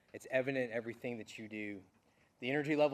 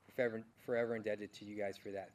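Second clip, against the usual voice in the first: ordinary talk, nothing marked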